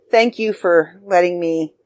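normal talking voice

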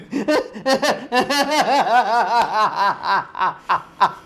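long, high burst of real laughter; helpless laughter. Real, not acted. Tickled by the film scene, helpless laughter. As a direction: crack up completely at your own retelling. Cracks up at own retelling.

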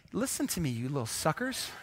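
hammed-up quiet fury; mock rage. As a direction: growl it low under your breath, like a comic villain. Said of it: "I just got into a little bit of a rage".

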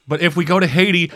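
ordinary talk, nothing marked

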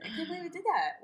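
usual speaking voice

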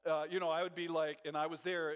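usual speaking voice

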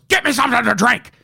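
harsh, high, rushed character voice; Tony Clifton. Retold or imitated. Imitating the actor suddenly demanding a drink from a girl, rude put-on voice. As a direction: bark it out as a rude, demanding lounge act. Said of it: "he just slips into the Tony Clifton character".

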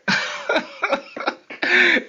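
high airy burst of laughter at his own joke; high breathy laugh. Real, not acted. Laughing at his own comeback to mom. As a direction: break into a high wheezing laugh.

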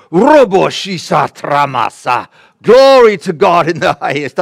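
very loud reenacted cry of praise; ecstatic praise outburst. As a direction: burst out in fervent praise, drawn out at first.

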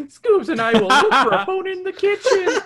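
goofy cartoon Shaggy announcing. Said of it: "Shaggy announces".

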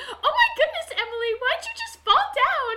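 high, giggly imitation of a quirky girl; quirky TikTok girl. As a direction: play an over-the-top quirky teen. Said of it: "those TikTok girls who are like".

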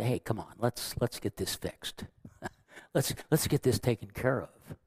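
put-on calm a sulking child gently; soothing parent. Parent calming an upset child, hushed and tender. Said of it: "put your arm around them and say".

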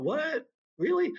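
high-pitched, incredulous questioning; retold disbelief. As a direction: pitch it way up, doubting what was heard. Retold first reaction of disbelief.

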